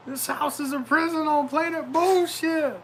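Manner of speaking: high, lightly voiced Step Brothers line; movie quote. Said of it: "Prison on Planet bullshit from Step Brothers".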